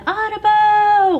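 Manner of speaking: high, loud, slow drawn-out "Audubon"; kid yelling Audubon. Retold or imitated. Boy at summer camp yelling his family name, slow loud holler. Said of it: "the whole camp after that was yelling".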